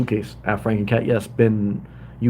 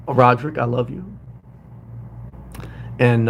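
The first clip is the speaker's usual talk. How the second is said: ordinary delivery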